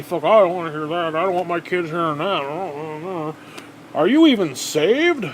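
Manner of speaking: slow, prissy churchgoer scolding. Retold or imitated. Ranting vlogger mocks a pious critic, slow and scolding. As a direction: sneer it like a scandalized believer. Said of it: "That's what they wanna say".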